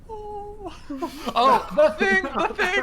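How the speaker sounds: high, slow giggly repeats; laughing excitedly. Real, not acted. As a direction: laugh through the words.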